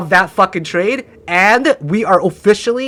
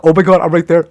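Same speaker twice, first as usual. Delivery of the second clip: ordinary talk, nothing marked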